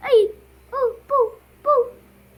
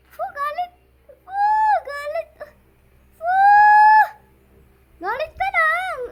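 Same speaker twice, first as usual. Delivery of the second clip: mock taunt; 12 semitones higher, 4 dB louder than usual. Real, not acted. Mocking angry player, high voice.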